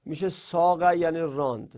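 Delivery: ordinary talk, nothing marked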